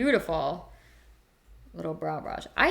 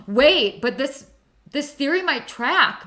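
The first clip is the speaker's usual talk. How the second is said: gasp, then a louder, higher wait; sudden realisation; 7 semitones higher, 6 dB louder, slower than usual. Real, not acted. Realising the tiger theory mid-chat, surprised gasp. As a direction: it just clicked, catch yourself. Gasps at tiger coronavirus news.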